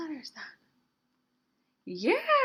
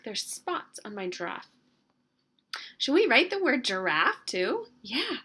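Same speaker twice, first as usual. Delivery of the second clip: usual speaking voice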